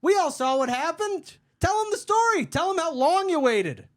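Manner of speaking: very high, loud taunt; mocking goading. Worker taunting the customer as she tells her story, loud goading. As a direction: push her to spill it, sarcastically.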